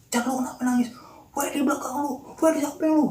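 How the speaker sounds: high-pitched, urgent shouting, re-enacted; retold yelling. Storyteller replaying friends yelling at crying Ocan, high urgent yells. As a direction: call out in mock alarm, pitch climbing. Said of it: "Jadi teriak-teriak gitu".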